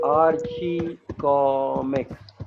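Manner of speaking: slow, low, drawn-out Archie comics; syllable by syllable. Real, not acted. Teacher typing out the title for a student, Archie comics drawn out slowly. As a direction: stretch each syllable of Archie comics, quite softly.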